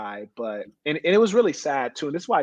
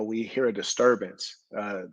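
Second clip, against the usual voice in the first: normal talking voice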